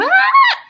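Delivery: sudden high crying scream; screaming while crying. Shown as a demonstration. Teacher demonstrating a crying girl's scream for the kids, high wailing shout. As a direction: let out a wailing cry, high and loud. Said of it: "Sumisigaw habang umiiyak".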